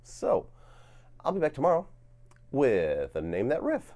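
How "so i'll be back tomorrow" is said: usual speaking voice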